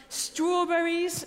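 savoured. Acted. Relishing the luxury.

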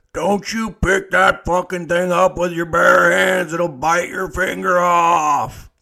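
loud, slow, bossy shout; shouted warning. Retold or imitated. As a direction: bellow the warning at the new guy. Deck boss shouting a warning.

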